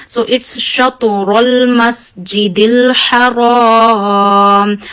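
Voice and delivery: Shatrul Masjidil Haram chanted very slowly, ra and alif thick; slow measured tajweed chant; Quran recitation. Shown as a demonstration. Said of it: "read alif also with a thick voice".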